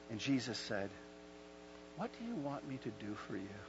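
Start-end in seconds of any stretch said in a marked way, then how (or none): 1.9-3.6 hushed, tender asking; gentle question. Acted. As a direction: ask softly, as Jesus to the blind man. Jesus gently addressing the blind man.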